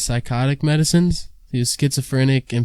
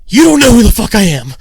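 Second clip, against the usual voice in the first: high, loud outburst as the crazed cellmate; mock yelling; 9 semitones higher, 9 dB louder than usual. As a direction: yell it like an unhinged inmate. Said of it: "like, don't yell".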